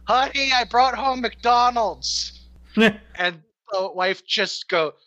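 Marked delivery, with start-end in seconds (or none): 0.1-2.4 high, sing-out greeting from the door; husband calling out. Acted. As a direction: call cheerily through the house as the husband. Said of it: "the husband going".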